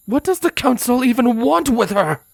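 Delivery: high, urgent angry demand; heated, confrontational question. Acted. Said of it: "Scarlet demanded".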